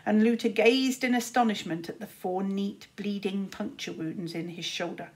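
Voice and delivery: plain reading voice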